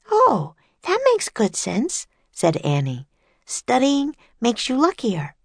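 put-on high, eager little girl's voice; bright young girl. A narrator's reading. Annie introducing herself and Jack to famous inventors, eager girl voice. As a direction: chirp it like an excited young girl.

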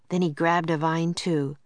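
usual speaking voice

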